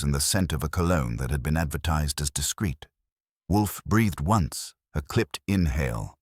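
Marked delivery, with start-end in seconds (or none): none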